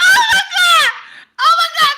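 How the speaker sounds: high, loud laughing oh my God; scream-laugh in delighted shock; real laughing shriek; shrieking laughter. Real, not acted. Shrieking laugh at own joke.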